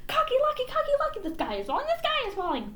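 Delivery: high, frantic hen cry; panicked chicken calling a rooster; yelling hen. A narrator's reading. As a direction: squawk it at the rooster. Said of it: "and yelled".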